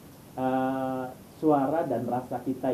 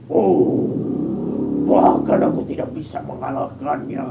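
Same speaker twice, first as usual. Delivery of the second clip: loud groaning big monster; 10 dB louder than usual. Shown as a demonstration. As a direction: roar it like a beaten giant. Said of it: "monster besar".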